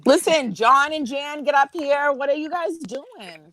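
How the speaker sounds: high-pitched summons; calling out. Pretend. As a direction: summon absent friends in a mock-demanding way. Calling friends up onto the stream.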